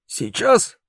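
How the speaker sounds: high, astonished question; incredulous. Acted. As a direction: ask it in disbelief.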